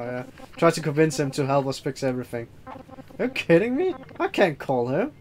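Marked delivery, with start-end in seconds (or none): none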